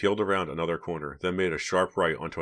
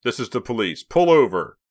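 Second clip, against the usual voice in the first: raised, commanding police announcement; loud higher-pitched officer's call; officer over loudspeaker; 5 semitones higher, 9 dB louder than usual. A narrator's reading. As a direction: order a driver to stop, building to a shout. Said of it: "came the distorted voice of an officer from the loudspeaker".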